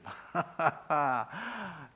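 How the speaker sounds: warm chuckle at his own joke; short happy laugh; hearty laugh. Real, not acted. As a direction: laugh it off with glee. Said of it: "as soon as I get to him".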